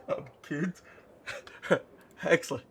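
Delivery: words between giggles; real amusement. Real, not acted. Reader enjoys Nanny's line about kids, laughing. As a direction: talk while recovering from laughter.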